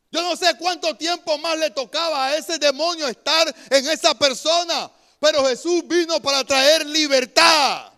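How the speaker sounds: loud preaching, hammering each phrase; fiery preaching. Acted. Preacher rousing his congregation with a loud sermon. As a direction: preach it out to the whole church.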